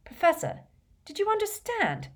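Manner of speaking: very high, disbelieving appeal; incredulous pupil pressing the teacher. A narrator's reading. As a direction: question him in high-pitched disbelief. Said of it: "repeated Harry incredulously".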